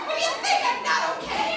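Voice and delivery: very high, loud and fast; hammed-up terror, laughing; laughing mock panic. Protests to floor director.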